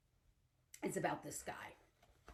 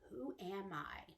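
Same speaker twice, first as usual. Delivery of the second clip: whisper; 4 semitones lower, 12 dB quieter than usual. Acted. Spooky riddle before alligator.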